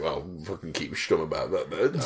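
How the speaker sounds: low, soft, urgent; quiet mock warning; hushed warning. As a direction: tell him quietly to shush. Hissing warning to stay quiet.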